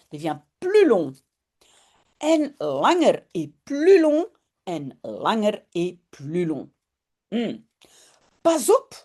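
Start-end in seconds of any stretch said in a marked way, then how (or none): 2.2-4.2 first take pitched higher, lively. Shown as a demonstration. Teacher says the phrase to students, higher and brighter. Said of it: "repeats the phrase for the class". 8.4-9.0 calling a warning. Acted. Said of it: "roept Janneke".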